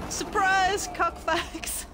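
high, loud surprise yell; loud shout. Real, not acted. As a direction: shout it out as an ambush. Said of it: "I just shouted that really loud".

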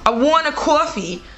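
attempted New York accent, deliberate and spaced out. Guessing game with a friend, attempted New York accent. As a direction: have a shaky go at New York. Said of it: "You're trying to do New York".

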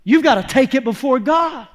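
usual speaking voice

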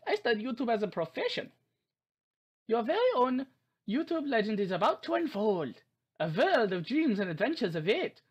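high, theatrical German professor voice; German accent. Acted. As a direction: narrate like a German game guide. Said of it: "I may just do a German accent".

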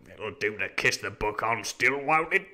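uneasy pirate growl. A narrator's reading. Dick worries over his cut Bible, growling. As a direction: growl it doubtfully, lifting at the end. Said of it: "growled Dick".

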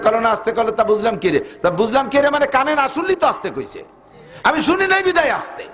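high, fervent sermon voice; preaching at full force. Acted. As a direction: preach it out with rising fire. (উচ্চস্বরে জোরালো ওয়াজ)

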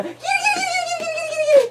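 very high sing-song call; high kitty call. Real, not acted. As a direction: squeal it like calling a cat. Calling to babies.

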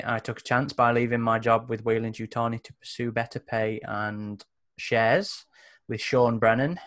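normal talking voice

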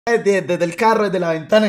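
usual speaking voice

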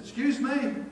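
high-pitched, affronted surprise; taken aback. Retold or imitated. Worker to the stranger who would fire him, taken aback. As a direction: react as if you can't believe your ears. Worker reacts to being told off.

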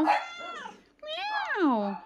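high, mock cat meow; imitated meow. Meowing at the cat.

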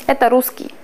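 ordinary talk, nothing marked

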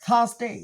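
"থার্সডে" as THAAS-day, R silent; British TH. Shown as a demonstration. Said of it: "একদম থ না".

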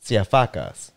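Siafakas said "see-ah-FAH-kahs"; Greek name pronunciation. Shown as a demonstration. Showing a co-host the surname, Siafakas in Greek pronunciation. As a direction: say Siafakas with open ah vowels, the Greek way. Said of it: "That's how you say my name in Greek".

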